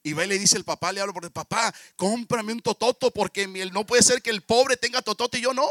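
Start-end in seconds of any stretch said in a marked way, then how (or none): 1.3-5.7 put-on whiny kid, louder and rising to the end; spoiled demand. Niño rico exige al papá. (niño mimado exigiendo a su papá, cada vez más fuerte)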